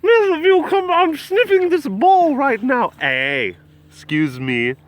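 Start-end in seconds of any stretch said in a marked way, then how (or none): 0.0-2.9 high, hammed-up posh British old lady. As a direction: Julia Child-like high British warble. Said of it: "posh old lady British accents".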